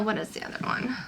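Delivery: a soft unvoiced mutter under the breath; whispered aside. Real, not acted. Vlogger whispering to herself while searching for a palette, hushed. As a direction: whisper it to yourself, trying to remember. Asking herself mid makeup tutorial.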